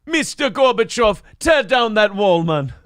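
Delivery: high-pitched Jamaican patter; Jamaican accent. Acted. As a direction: put on a comic Jamaican accent. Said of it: "you should be from Jamaica".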